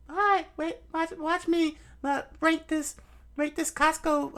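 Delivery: very high, squeaky cartoon voice; a tiny shrieking influencer; screaming YouTuber balls.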